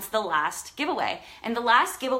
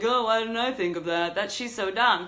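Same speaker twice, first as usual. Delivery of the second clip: mock scoffing viewer. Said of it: "sounds really lame and like".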